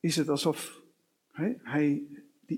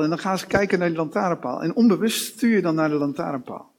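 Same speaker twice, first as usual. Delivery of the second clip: normal talking voice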